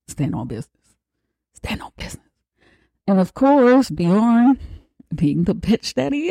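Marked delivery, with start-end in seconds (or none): 0.1-1.0 hushed aside. Retold or imitated. Repeated catchphrase, quietly. 1.5-2.2 breathy whispered repeat; whispered catchphrase. Retold or imitated.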